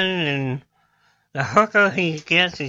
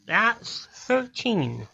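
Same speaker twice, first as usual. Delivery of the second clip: slow, soft dim-witted student; Rodney; 8 dB quieter, much slower than usual. Acted. Dumb student working out the principal's sum, slow and earnest. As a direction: puzzle it out very slowly, word by word. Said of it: "And Rodney goes".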